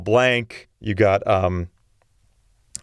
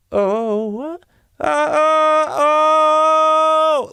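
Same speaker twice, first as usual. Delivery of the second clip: high sung oh; old top note; 13 semitones higher, 3 dB louder, much slower than usual. Shown as a demonstration. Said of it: "that's the highest note I could sing".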